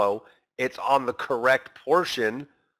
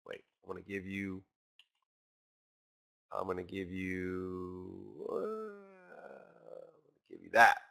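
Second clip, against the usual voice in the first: teasing market voice; 3 semitones lower, 8 dB quieter than usual. Retold or imitated. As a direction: sly taunt that fades to a near-whisper and slows down. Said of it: "It's like".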